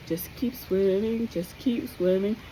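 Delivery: slow, soft cartoon fish sing-song; Dory. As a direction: hum it like a cheerful forgetful fish. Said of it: "I think about Dory".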